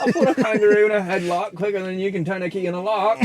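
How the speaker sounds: loud, high Aussie boast; Australian voice on the edge of laughter; mock Australian accent. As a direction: brag in over-the-top Australian. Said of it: "that's Australian".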